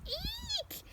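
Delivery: very high squeaky eek; a tiny pretend scream of fright; mock shriek. Reading to a child, a pretend squeak of fright.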